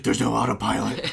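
hammed-up loud grievance; mock outrage. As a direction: rage at it in a comic riff. Comic riff on furious pilot.

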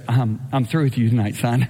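lightly voiced Lord, deep and settled; God's voice. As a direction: speak as God, calm and final. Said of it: "the Lord goes".

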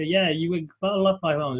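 ordinary talk, nothing marked

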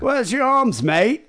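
put-on high, jeering English accent; English lout accent. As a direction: taunt him like a drunk English lout. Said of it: "drunken English louts just going".

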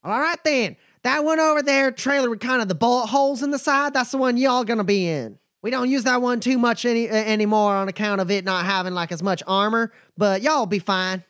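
a wary but friendly trail boss sizing folks up; nomad Bumper. Acted. GM voices Bumper.

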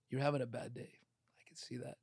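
whispered, gentle and unhurried; hushed understanding to an upset wife; soft whisper. Retold or imitated. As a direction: soothe her in a whisper. Calm reply to angry wife.

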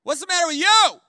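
loud, high "Hey" and demand; dad's shout. Retold or imitated. Dad yelling at his moody son in the car. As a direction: bark it like a fed-up dad. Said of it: "He's like".

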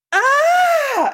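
sudden high sharp shriek of a scream; retold scream. Retelling Miss Luton's fright at the mug, a high sharp scream. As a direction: yelp as if the tea burned you. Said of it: "Do you know why she screams?".

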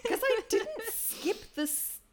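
high, slow laughing protest; defending yourself mid-laugh. Real, not acted. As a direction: laugh the excuse out. Defending self while laughing.

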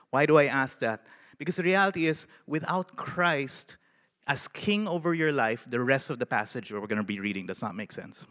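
ordinary delivery

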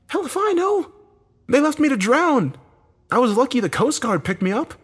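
loud, very high outburst; heated, high-pitched dismissal; angry retort. A narrator's reading. Acted quarrel, dismissive angry reply.

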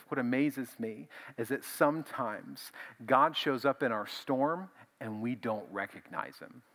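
ordinary talk

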